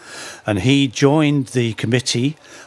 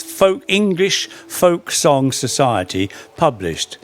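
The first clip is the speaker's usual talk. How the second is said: ordinary talk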